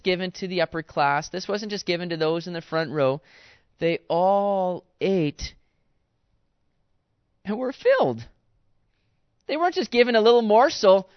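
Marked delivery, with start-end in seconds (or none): 3.8-8.3 "They all ate" stretched very slowly, "all" stressed; slow emphatic. Acted. Preacher to congregation, slow and emphatic. 9.5-11.1 emphatic. Acted. Indignant emphasis.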